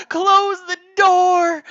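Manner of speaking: high, loud, drawn-out scream; panicked shout. Pretend. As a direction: yell it in a terrified stretch. Kids leap over couch.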